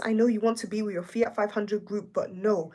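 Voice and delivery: ordinary talk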